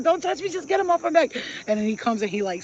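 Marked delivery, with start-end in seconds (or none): 0.0-1.3 high loud frantic order; yelling. Retold or imitated. To the cab driver on the street, frantic yell. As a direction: bark at him to just brush them off. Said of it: "I said".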